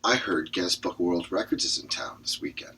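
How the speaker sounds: conspiratorial whisper; whispered scheme. Acted. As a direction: lean in and whisper the plan. Character proposes idea.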